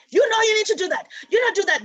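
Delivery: high, loud mock scolding of a child; imitating a scolding elder. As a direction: wag the finger like a strict elder.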